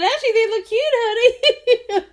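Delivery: high, laughing, teasing compliment; teasing sweet voice. Retold or imitated. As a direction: sweetly tease your husband. Teasing husband in jeans.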